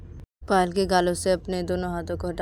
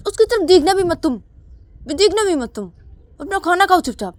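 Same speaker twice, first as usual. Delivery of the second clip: much higher, louder harsh rebuke; scolding mother; 12 semitones higher, 11 dB louder than usual. Acted. Mother to Ananya, harshly scolding. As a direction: tear into the child. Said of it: "तो तभी अनन्या बोलती कि".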